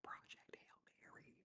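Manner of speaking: hushed, secretive whisper, no voice. Acted. Secret book tip to viewers.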